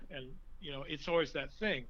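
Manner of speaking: normal talking voice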